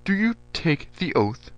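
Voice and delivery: a general testing the major's loyalty; stern general. A narrator's reading. General demanding an oath.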